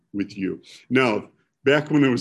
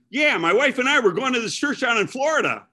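caller's voice; 12 semitones higher, 3 dB louder than usual. Retold or imitated. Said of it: "and he says".

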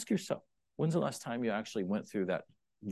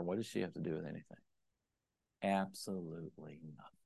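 much softer, quick rueful aside; sheepish aside; 7 semitones lower, 12 dB quieter, faster than usual. Acted. Preacher admitting he barked at his wife, soft rueful aside. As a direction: admit it quietly.

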